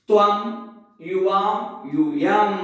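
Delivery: त्वम, युवाम, यूयम one at a time, with gaps; measured drill of त्वम, युवाम, यूयम, pausing after each; gaps for repetition. Shown as a demonstration. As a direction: recite त्वम, युवाम, यूयम for students to echo, drawing out the last. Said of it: "हम बीच में gap इसीलिए देते हैं".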